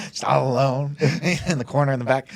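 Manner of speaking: words squeezed out between laughs; laughing. Real, not acted. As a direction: laugh at your own gloomy picture. Laughing at his own sad story.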